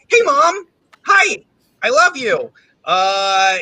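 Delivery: normal talking voice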